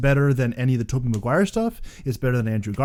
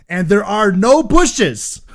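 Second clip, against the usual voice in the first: there are no bushes said louder, higher and slow, leaning on no; drawn-out, louder punchline; punchline emphasis; 6 semitones higher, 10 dB louder, much slower than usual. Real, not acted. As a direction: land the punchline big and slow. Punchline of the retold scene.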